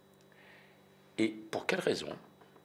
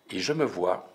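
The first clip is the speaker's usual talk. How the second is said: ordinary delivery, nothing marked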